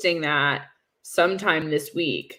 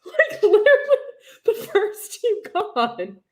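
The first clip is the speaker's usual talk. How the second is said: laughing; 12 semitones higher than usual. Real, not acted. Laughing at her team's exit.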